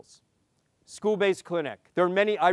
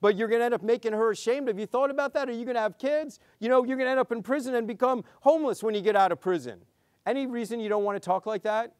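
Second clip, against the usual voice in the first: preachy lecture; 5 semitones higher, faster than usual. Retold or imitated. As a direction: hammer her with a nagging adult lecture. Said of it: "this is what the lecture sounds like".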